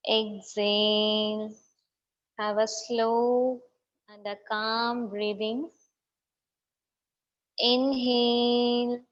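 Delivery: slow, drawn-out meditation cues; guided breathing. Real, not acted. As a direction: stretch "inhale" and "exhale" into long, steady tones. Leads slow breathing exercise.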